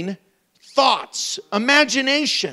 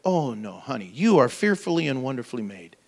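tender reassurance; 8 semitones lower, 4 dB quieter than usual. Pretend. Husband comforting wife.

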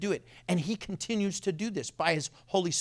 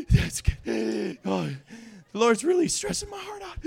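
high, gasping, hammed-up wheeze between phrases; mock breathlessness; 3 semitones higher than usual. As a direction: pretend gasping on an inhaler. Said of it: "If you need your inhaler".

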